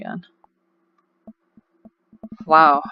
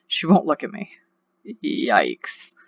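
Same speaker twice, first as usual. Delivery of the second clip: ordinary talk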